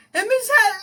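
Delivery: high, loud greeting through laughter; laughing imitation. Caller greeting Michelle on the phone, high and laughing. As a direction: act out the call, cracking up.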